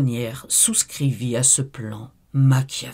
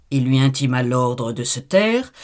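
ordinary delivery, nothing marked

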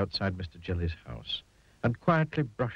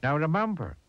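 higher, emphatic friendly reminder; kindly tramp; 8 semitones higher, 3 dB louder than usual. A narrator's reading. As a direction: send the nervous chap off with advice. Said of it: "he said to Mister Jelly".

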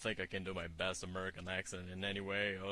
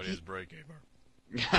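mock deep voice; 4 semitones higher, 5 dB louder, slower than usual. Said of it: "wants to sound like his testicles dropped".

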